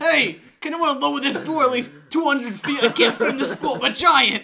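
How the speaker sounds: loud, laughing booming giant; goofy giant voice. Pretend. Mocking the huge superhero at the school door, loud and laughing. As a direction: boom it out like a giant stuck outside a school. Joking about huge Cyborg actor.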